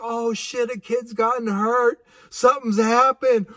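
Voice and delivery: half-mocked panic; thinking a kid is hurt, mildly alarmed. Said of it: "I was like".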